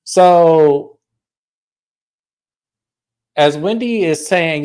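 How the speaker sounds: ordinary talk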